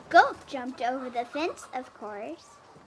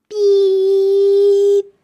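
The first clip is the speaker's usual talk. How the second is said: loud, long higher beep; happy beep; 6 semitones higher, 16 dB louder than usual. A narrator's reading. Blue offers everyone a ride, long loud beep. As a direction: give a long glad beep. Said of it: "said Blue".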